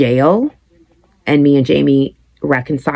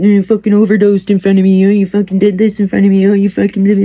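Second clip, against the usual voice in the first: higher, louder mocking teen voice, quick; imitating daughter's taunts; 7 semitones higher, 5 dB louder, faster than usual. Mother imitating her teenage daughter's taunts, mocking. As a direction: mimic a bratty daughter throwing it in her face. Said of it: "she'll say things to me like".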